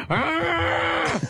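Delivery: high hammed-up shriek of rage; mock angry scream. Mother shrieking before biting her hand, mock scream. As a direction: shriek like a crazed mom. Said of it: "she'd get mad and go".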